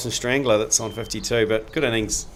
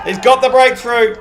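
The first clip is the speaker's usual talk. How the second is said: much higher and louder, shouting the breakthrough; excited commentary call; 14 semitones higher, 11 dB louder than usual. Acted. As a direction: call the wicket like a live commentator at full voice. Commentator calls the wicket.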